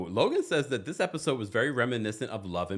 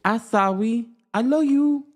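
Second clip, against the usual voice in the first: high, loud gushing cry; 10 semitones higher, 8 dB louder than usual. Real, not acted. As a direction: squeal your love at the chat. Gushing at a chatter.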